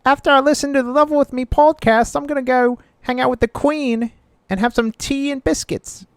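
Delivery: camp it up as a cartoon Londoner; mock British accent. Hosts mocking European listeners, in a hammy high British accent.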